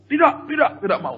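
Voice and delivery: imitated snappish no, starting high; curt refusal. Mother refusing the souvenir sellers, curt refusal. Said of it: "Dan jawabnya ketus sekali".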